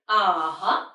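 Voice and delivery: UH-HUH dipping low, then rising; third tone. Shown as a demonstration. Teacher shows English-style third tone, dipping UH-HUH. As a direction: slide UH-HUH down first, then up. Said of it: "go down first and".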